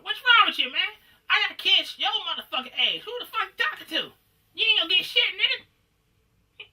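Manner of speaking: high, loud mock yelling at a sparring partner; hammed-up furious trash talk, pitched high; hostile yelling. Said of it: "That's how he talk".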